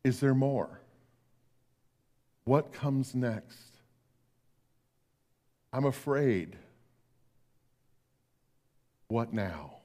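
soft and slow, fading to almost nothing; quiet and afraid. Retold or imitated. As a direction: ask like a frightened dying man. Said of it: "very, very quietly".

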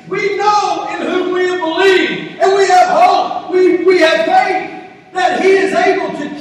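loud, high, fervent proclamation; full-force preaching. Real, not acted. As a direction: declare the faith at the top of the voice, easing at the end.

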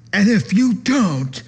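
high, loud put-on threat; hammed-up bossy pastor threat; mock threatening pastor. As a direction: caricature a controlling pastor.

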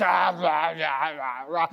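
wordless caveman munching; growly chomping as if wolfing food; mock gobbling noise. Mindless caveman eating.

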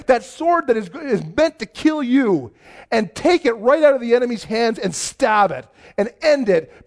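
rally the congregation against fear; full-force preaching. Acted. Sermon climax, preaching at full force.

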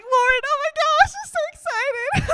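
high, loud, giddy squeal of joy; overjoyed squeal at meeting the woman; excited squeal. Retold or imitated. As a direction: shriek it out like you can't contain it. Retold burst of joy.

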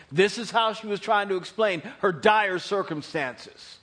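ordinary delivery

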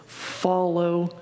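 quiet, unhurried command; calm invitation. Acted. Teacher answers disciple without scolding, calm and slow.

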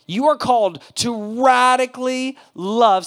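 slow, loud emphatic proclamation; leading a congregation, slow and loud; preaching call. Real, not acted. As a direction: proclaim it for the crowd to repeat. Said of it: "Say it with me".

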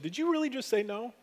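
gently incredulous question, voice lifting at the end; retold pointed question. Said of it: "the Lord poked me in the side and said".

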